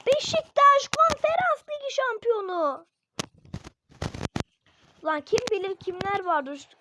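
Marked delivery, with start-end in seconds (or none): 0.1-2.9 çok ince, yüksek ve gür sesle anons et; şampiyonluk anonsu. Pretend. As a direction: telefonda çıkan tiz bir ses gibi ilan et. Repeat of phone voice announcement. (telefon açılınca duyulan tiz anons sesi)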